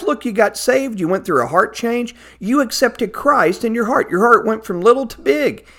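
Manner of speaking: ordinary talk, nothing marked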